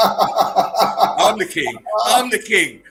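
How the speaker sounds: loud triumphant laughing shout; gleeful shout. Acted. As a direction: crow it like a king. Reading meme, shouting gleefully.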